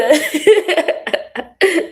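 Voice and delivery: high, loud cackling laugh. Real, not acted. Speaker joking to future love, loud high laugh. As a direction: crack up at your own joke. Laughs at her own rude joke.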